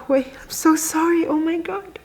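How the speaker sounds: real tearful apology, voice jumping high; super emotional. Real, not acted. Apologizing to viewers while recalling crying by the road, super emotional. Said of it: "I'm being super emotional right now".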